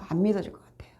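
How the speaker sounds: 안 믿어질 것 같아요 repeated in a breathy whisper. Shown as a demonstration. Preacher confiding doubt to the congregation, whispered.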